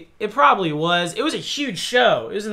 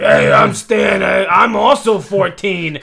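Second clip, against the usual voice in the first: put-on creepy man faking teen; 4 dB louder, slower than usual. Grown man pretending to be teen.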